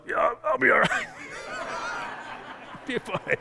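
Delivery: breathless, strained reassurance; telling his son he's fine while hurting, breathy and strained; strained brave face. Retold or imitated. Injured dad brushing off his son's worry, strained. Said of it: "and I'm like".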